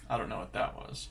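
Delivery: usual speaking voice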